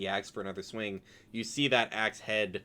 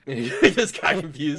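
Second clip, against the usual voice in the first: ordinary delivery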